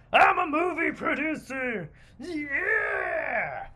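high, loud, hammed-up dumb producer yelling; dimwitted producer. Movie producer overexcited by a pitch, shouting. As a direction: shout it out like an overexcited man child. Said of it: "producer was like".

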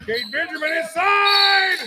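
slow, loud, high shout of the play; excited shout. Real, not acted. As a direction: call it out big to the crowd. Live basketball call, big play.

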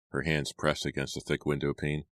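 usual speaking voice

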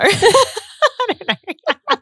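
slow, high laughing. Real, not acted. Laughing after joke.

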